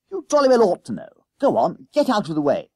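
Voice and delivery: loud, high schoolboy scolding; heated playground squabble; angry retort. Acted. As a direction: order him off impatiently. Boys quarrel over the basin.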